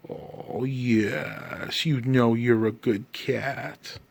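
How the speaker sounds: soft, slow, whispered pet talk; whispering to the cat. Real, not acted. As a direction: hush to the cat like you're lulling it.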